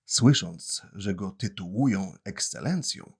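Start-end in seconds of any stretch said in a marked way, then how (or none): none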